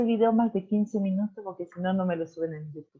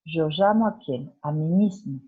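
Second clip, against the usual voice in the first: ordinary delivery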